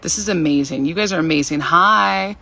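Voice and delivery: ordinary talk, nothing marked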